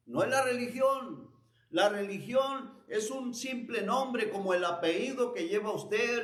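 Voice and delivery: emphatic preaching. Real, not acted. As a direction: preach it with urgent conviction. Preaches with raised, insistent voice.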